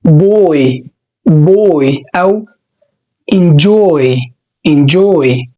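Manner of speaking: put-on "boy" and "enjoy" with an oy glide, slowly; model pronunciation. Shown as a demonstration. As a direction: stretch the oy in "boy". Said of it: "فبنقول". (نطق boy و enjoy)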